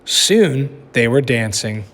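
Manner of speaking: ordinary delivery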